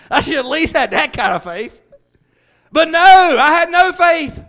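high, loud, climbing sermon voice; preaching at full force. Real, not acted. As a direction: build the preaching until it rings.

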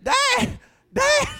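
very high, loud frantic yell; panicked little-girl shout. Retold or imitated. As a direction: scream for Dad like a lost child. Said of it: "I started yelling like a little, little girl".

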